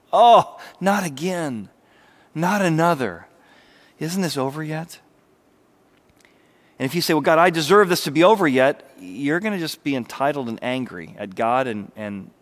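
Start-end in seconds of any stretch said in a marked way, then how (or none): 0.1-3.3 mock groan. 7.1-8.9 entitled complaint. Acted.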